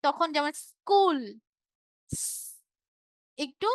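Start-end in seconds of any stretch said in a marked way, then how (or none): 0.6-1.2 "স্কুল" with a clear hissed s at the start; dental S. Shown as a demonstration. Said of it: "এস এর উচ্চারণ যদি বাংলায় বলি". 2.1-2.5 "স-" as a long thin sss hiss; dental S sound. Shown as a demonstration. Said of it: "দন্তাস উচ্চারণ করি".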